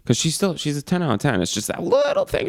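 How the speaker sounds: ordinary delivery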